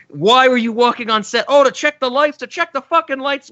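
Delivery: hammed-up angry yell; mock rant. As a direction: imitate a petty angry outburst. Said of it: "he's yelling at this lighting guy".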